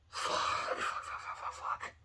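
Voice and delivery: dismayed whisper, fading away; voiceless, helpless repeated swearing; whispered cursing. Acted. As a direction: whisper the curse over and over. Voicing the hero's despair.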